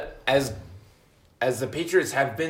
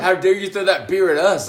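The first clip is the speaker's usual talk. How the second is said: louder, hammed-up outrage; mock indignant yell; 4 semitones higher, 6 dB louder than usual. Retelling the bar fight to a friend, mock outrage. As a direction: play up the offended drunk.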